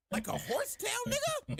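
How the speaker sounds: high-pitched disbelief; incredulous high squeal. Real, not acted. Mocking Zuko's ponytail.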